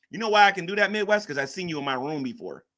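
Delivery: ordinary delivery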